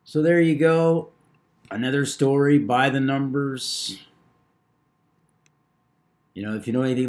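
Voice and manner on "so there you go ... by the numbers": loud sign-off. Real, not acted. Channel sign-off catchphrase.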